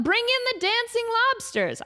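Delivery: high, grand call to bring them on; show host announcement. Retold or imitated. Host calling in the dancing lobsters, high grand announcement.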